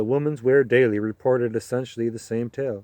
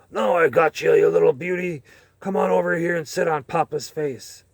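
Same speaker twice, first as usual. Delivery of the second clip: louder, higher yelled taunt; drunken passenger's yell; 4 semitones higher, 4 dB louder than usual. Acted. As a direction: yell it out like a drunk grabbing someone. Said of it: "start yelling stuff like".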